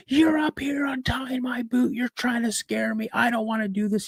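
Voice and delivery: high, strained accusing wail; retold screaming. Boy accuses dad of untying his boot, high retold wail. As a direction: imitate a panicked kid accusing Dad, volume held back. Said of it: "screaming like a banshee".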